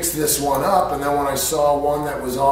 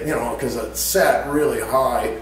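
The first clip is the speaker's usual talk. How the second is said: usual speaking voice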